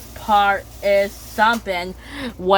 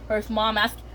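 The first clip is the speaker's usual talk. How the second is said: plain reading voice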